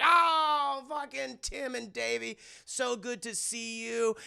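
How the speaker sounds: high, gushy welcome; gushing greeting. Retold or imitated. Family greeting the boys at the door, high and gushing. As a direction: throw the arms open in welcome.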